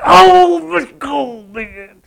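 high, loud groan falling away; frustrated outburst. Acted. Preacher acting out when you gnash your teeth, exasperated wail. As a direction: wail it in exasperation, then tail off.

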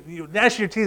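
ordinary delivery

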